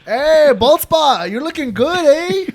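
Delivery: put-on high, drawn-out Spanish-accented greeting. Teasing a friend by nickname in a Spanish accent. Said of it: "My Spanish accent?".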